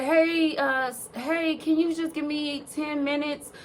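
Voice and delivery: a lightly voiced stylist asking for time; imitating her high voice. Imitating hairdresser at door.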